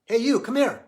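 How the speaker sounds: loud, high summons; calling out. Retold or imitated. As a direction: call him over across a train car. Old fellow summons drunk guy.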